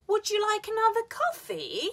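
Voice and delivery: very high, COFFEE dips then rises; high-pitched lift on "coffee"; polite fall-rise question. Shown as a demonstration. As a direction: swoop up on "coffee" to invite. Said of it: "invite somebody to".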